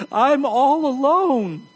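mock the sufferer's lonely moan; whiny self-pity. Sufferer to caring family, a slow mock whine. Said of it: "and you say".

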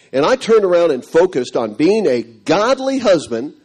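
ordinary talk

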